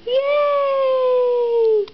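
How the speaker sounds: put-on high, loud cheer for the baby; cheering yay. Shown as a demonstration. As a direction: cheer it out big to show her how. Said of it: "Say yay!".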